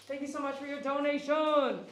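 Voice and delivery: loud, bright stream-host thank-yous; donation shout-outs. Acted. Groomer thanking livestream donors, upbeat announcer read. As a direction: hype up every donor like an announcer.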